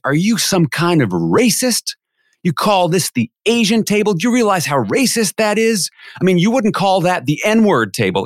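high, outraged accusation, acted out; mock angry yell. Offended guest accuses the MC over table name, imitated yelling. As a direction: imitate an indignant man laying into someone. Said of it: "he starts yelling at me".

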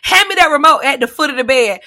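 a hint of a demanding grandma; bossy grandma. Grandma ordering grandchild to fetch remote, bossy.

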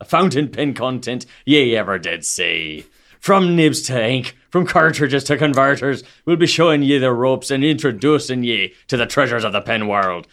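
hearty hammed-up pirate accent; Caribbean pirate accent. Co-host cold-reading a show intro in mock pirate accent. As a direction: roll out a full pirate accent, phrases swooping down. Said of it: "style of a Caribbean pirate".

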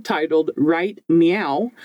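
normal talking voice

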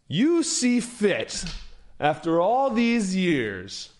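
put-on the old rival lets every word land; slow menacing rival. A narrator's reading. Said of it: "Ardelane stands there and says".

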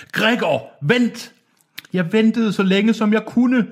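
high, strained tormented call; pained old man's cry. A narrator's reading. Old man calling Gregor to wait, pained high cry. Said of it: "råbte den gamle mand med forpint stemme".

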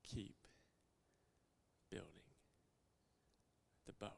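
low whisper, each word apart; whispered refrain. Acted. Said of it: "whispered refrain".

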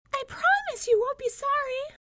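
very high, happy little mouse voice. A narrator's reading. Mouse reassures her new sweetheart, happily. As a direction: promise it gladly in a tiny squeak. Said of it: "she said happily".

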